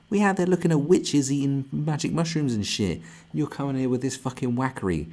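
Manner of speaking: normal talking voice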